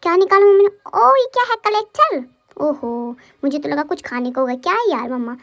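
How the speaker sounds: put-on cute babyish voice, speaking as the toddler. Mother narrating as her baby to viewers, in a cute baby voice. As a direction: voice the baby's thoughts, playful and sweet.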